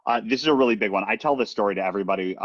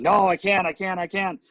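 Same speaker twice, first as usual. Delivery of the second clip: high, excited bragging; excited sister; 7 semitones higher than usual. Retold or imitated. Sister bragging about the rival's undeserved job, high and excited. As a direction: gush about her rival, voice pitched way up. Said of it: "She's like".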